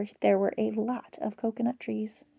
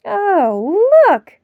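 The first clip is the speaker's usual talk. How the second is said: excited cry; 5 semitones higher, 7 dB louder than usual. Acted. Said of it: "cried Toto".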